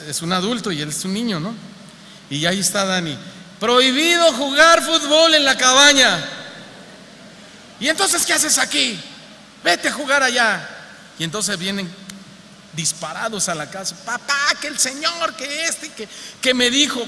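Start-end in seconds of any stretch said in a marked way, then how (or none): 3.6-10.7 high, loud mimicry of the boy reading the sign; mocking childish reading. Pastor imitating his son reading the sign aloud, high and loud. 14.0-17.0 put-on excited child.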